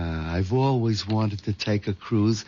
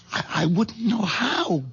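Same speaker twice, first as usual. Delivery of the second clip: tearful; 10 semitones higher than usual. Acted. Henry frightened at murder plan.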